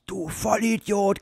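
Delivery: put-on wife scolding her drunk husband. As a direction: tell him off like a fed-up wife. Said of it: "kommt seine Frau".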